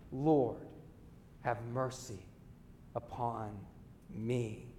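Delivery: quiet kneeling plea; humble prayer. Retold or imitated. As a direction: tax collector begging God, hushed and humble. Tax collector prays on knees.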